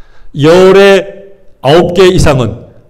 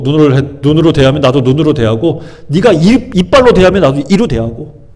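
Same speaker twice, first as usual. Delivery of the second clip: normal talking voice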